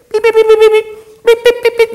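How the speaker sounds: very high, even electronic beeps; squeaky repeated machine beeping; metal detector beeps. Acted. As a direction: imitate a metal detector scanning. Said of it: "I'm a metal detector".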